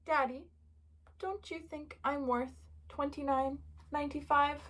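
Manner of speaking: put-on hushed little-kid plea; quiet. A narrator's reading. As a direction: ask Dad very gently, with little pauses. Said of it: "quietly said".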